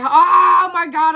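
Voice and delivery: loud, high, drawn-out cry; imitating a gushing viewer; mock dramatic exclamation. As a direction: stretch it out with big drama. Acting out viewer's regretful exclamation.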